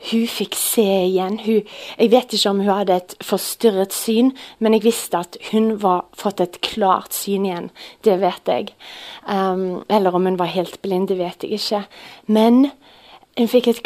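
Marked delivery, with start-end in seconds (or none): none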